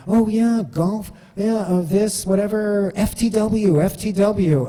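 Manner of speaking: high, excited cheering voice; imitating coworkers piling on the cheers; mock coworkers tossing out slang. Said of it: "And then".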